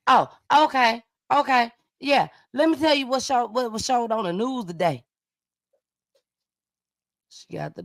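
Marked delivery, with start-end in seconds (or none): 0.1-5.1 higher acted back-and-forth, settling at the end; phone call reenactment. Host replaying the call about the news, higher acted voice. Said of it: "play back the phone conversation".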